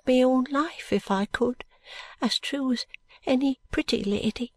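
high, earnest, slow protest; pleading poor mother. Acted. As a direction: insist you'd die for your child. Mother protests her love to Bucket.

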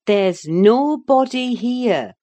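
slow, emphatic insistence; insistent. A narrator's reading. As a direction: insist loudly to calm someone's delusion. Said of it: "I insisted".

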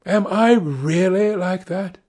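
high, slow wondering question; eager cry. A narrator's reading. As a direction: ask it with lifted, drawn-out wonder. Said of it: "cried the original of the portrait".

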